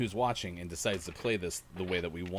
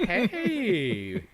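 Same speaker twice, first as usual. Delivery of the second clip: laughing shout; 11 semitones higher, 10 dB louder than usual. Real, not acted. Laughing greeting to enemy.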